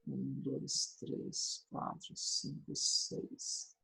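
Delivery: barely audible whispered count; counting under breath. Real, not acted. Teacher rechecking the syllables of the verse, whispering the count. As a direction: whisper the numbers slowly to yourself.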